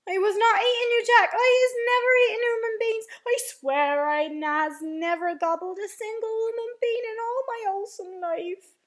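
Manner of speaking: high, loud, frantic denial; screeched. A narrator's reading. Giant swearing innocence to Jack, frantic screech. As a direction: protest in a wailing panic. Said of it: "he screeched".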